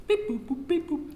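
high sung phone beeps; high mock dialling beeps; imitated dial tones. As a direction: make the keypad noises with your voice. Imitating phone keys while dialling.

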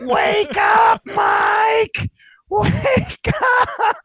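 put-on very high, loud little-girl shout; toddler yelling. Toddler niece waking her hungover uncle, very high excited shout. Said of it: "Cheese is up".

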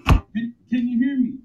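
muffled, far-off phone mic; speaking through the car console phone; underwater phone sound. Real, not acted. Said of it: "You sound like you're underwater".

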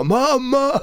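high desperate scream of "Mamma"; a child shrieking after the departing taxi; screaming for mum. Retold or imitated. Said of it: "skriker liksom för full hals". (gallskrik efter mamma)

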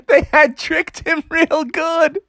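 tickled, barely holding in laughter; laughing. A narrator's reading. Narrator laughing at the trick on Froggy.

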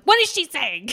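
high, loud mock-frustrated demand; playful high-pitched wail of not hearing; exasperated outcry. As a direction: yell it at the TV in comic frustration. Retold frustration at inaudible whispering.

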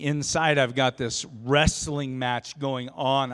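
ordinary talk

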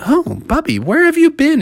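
high, surprised family; calling him home. Acted. Family greets bear.

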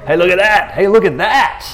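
high, loud call of hey, look at that; hammed-up Jesus calling out; mock call-out. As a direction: point it out for everyone to hear, playful. Jesus pointing out the elephant.

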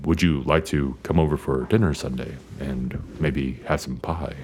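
low, soft, measured dad voice; very low, quiet, careful invitation; impression of dad. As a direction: imitate a softly well-spoken father. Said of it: "my dad has a very".

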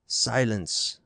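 a mild mock thunder from the stern father; father silencing his defiant son, mildly thundered. A narrator's reading. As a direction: cut him off with a lightly voiced command. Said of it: "thundered Teuxical".

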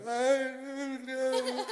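drawn-out high wail; comic crying squeal; mock bawling. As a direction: blubber it very slowly and high, hammed up. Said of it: "I'm bawling like a".

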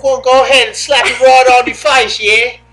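hammed-up British lad, playful; British accent. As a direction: put on a cheeky British accent. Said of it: "I need the British one".

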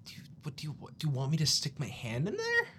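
ordinary talk, nothing marked

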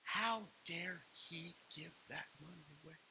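slow, drawn-out indignation; seething mock anger. Pastor inwardly mad at Jeremy's giving, mock-seething. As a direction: seethe it word by word, slowly. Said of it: "I was so mad".

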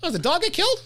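high-pitched worried asking; high, mock-anxious question; dog-lover's question. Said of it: "that's how you ask that question".

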